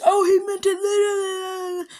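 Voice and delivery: high, drawn-out crybaby wail; exaggerated mocking whine, dragged out; mock tattling whine. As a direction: imitate a whiny kid making excuses. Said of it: "he's all trying to make the excuse".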